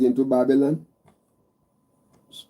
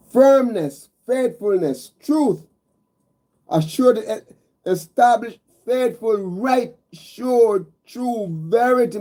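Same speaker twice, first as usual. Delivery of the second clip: higher pitched, rising preacher's litany of words; lifting the congregation word by word; fervent preaching; 6 semitones higher, slower than usual. Acted. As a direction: call out each attribute like a rising chant. Preacher lists God's attributes.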